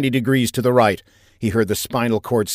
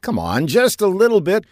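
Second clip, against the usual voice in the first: higher, insistent calling; exasperated pleading; 8 semitones higher than usual. A narrator's reading. Freezing captive pleading with the pilot, exasperated and raised. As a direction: press the request through a closed door. Arguing through a closed door.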